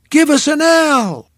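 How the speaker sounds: high, loud cheer call; cheerleader yell. Acted. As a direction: shout it to the crowd like a cheerleader. Cheerleader chant.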